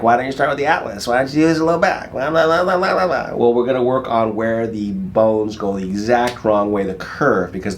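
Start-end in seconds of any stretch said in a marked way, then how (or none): 0.0-3.4 higher-pitched mock questioning, trailing into blah-blahs; doubting viewer. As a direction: imitate a nagging questioner, pitch climbing. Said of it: "And you're like".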